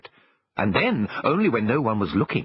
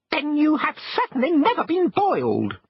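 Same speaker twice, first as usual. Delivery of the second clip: very high, tiny squeaky shrimp voice, indignant; shrimps' voice. Acted. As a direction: squeak it out like offended little shrimps. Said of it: "they answered".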